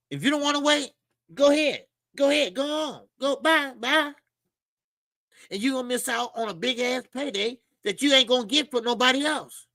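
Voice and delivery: high-pitched taunting send-off; playful high-voiced brush-off; mock dismissal. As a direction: wave him away in a high teasing voice. Mock dismissal of complaining fighter.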